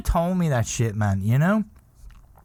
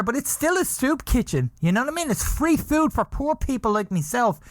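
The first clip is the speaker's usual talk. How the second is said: mock rant; 7 semitones higher than usual. Imagined rant at Krishnas.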